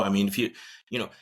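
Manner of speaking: ordinary talk, nothing marked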